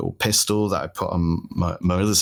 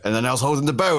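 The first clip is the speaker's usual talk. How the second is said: ordinary talk, nothing marked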